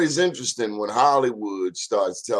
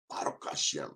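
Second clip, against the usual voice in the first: Baruch Hashem whispered, much softer; whispered echo; 7 semitones lower, 11 dB quieter than usual. Shown as a demonstration. Host echoing Hebrew greeting to viewers in a whisper. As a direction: breathe Baruch Hashem as a hushed aside. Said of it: "Hope everything is Baruch Hashem".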